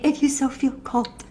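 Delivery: airy mock "If you so feel called"; mock limp phrase. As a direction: sneer at the weak ending. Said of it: "if you're saying".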